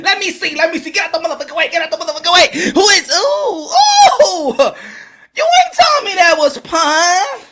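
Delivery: high, pushy mock excitement breaking into oohs; hammed-up astonished recognition; girlfriend starstruck. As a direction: shove in to look, then swoon. Acted girlfriend pushes in, recognises Punch.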